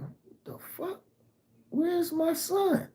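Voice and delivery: high, breathless whispered panic; panicked whisper. Retold or imitated. Walking the neighborhood hunting for his son, frantic high whisper. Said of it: "I'm walking around looking".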